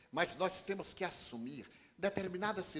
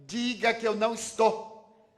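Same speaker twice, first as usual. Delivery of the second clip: louder, higher, fed-up order to the doorman; irritated snap; 6 semitones higher, 8 dB louder than usual. Retold or imitated. The preacher to the insistent doorman, irritated loud command. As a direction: get rid of him, voice tight with annoyance.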